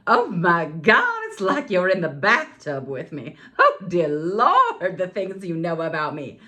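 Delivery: mock mortified. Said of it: "I'm like".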